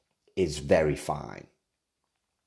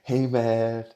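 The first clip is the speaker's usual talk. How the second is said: higher, laid-back cool guy drawl; bad lightly pitched-up Clooney voice; George Clooney impression; 7 semitones higher, 4 dB louder, much slower than usual. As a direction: mimic a mellow Hollywood star, badly. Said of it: "I can't do a, an impression of George Clooney".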